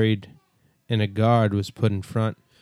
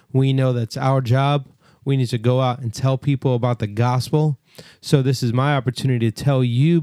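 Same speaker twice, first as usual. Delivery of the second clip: preaching; 4 semitones higher than usual. Acted. Exhorting listeners to evangelize.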